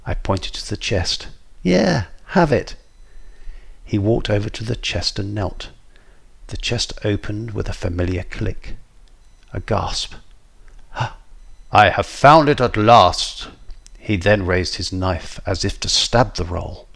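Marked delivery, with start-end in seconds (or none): 1.6-2.8 high, slow, breezy giveaway; carefree offer. A narrator's reading. Narrator points villain to the chest, carefree high offer. 11.7-13.5 loud, slow, gloating declaration; triumphant villain. A narrator's reading. Villain opens the chest on the treasure, loud triumph.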